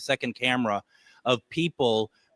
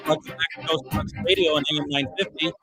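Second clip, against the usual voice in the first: ominous late night radio; 3 semitones higher, faster than usual. Acted. Spooky radio station bumper.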